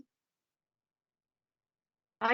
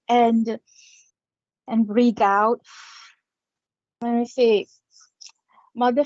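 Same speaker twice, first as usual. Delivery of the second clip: guided meditation prayer; 5 dB louder than usual. Acted. Medium leading viewers in a breathing prayer, louder and solemn. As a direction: call the spirit people in, steady and solemn.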